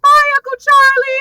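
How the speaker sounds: high, loud child imitation; put-on kiddie voice calling goodbye; cute little kid. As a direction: chirp it like an adorable little kid. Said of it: "And the kid is so cute".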